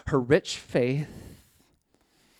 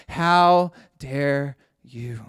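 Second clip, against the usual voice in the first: slow rebuke; much slower than usual. Acted. Jesus to the scribes and Pharisees, a slow heavy rebuke. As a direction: drag out how dare you, slow and heavy. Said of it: "he was saying to the scribes".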